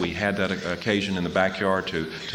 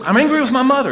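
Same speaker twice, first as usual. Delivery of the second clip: higher, louder burst; angry admission; 9 dB louder, faster than usual. Retold or imitated. To his counselor in group, loud high admission.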